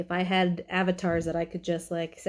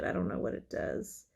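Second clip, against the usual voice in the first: normal talking voice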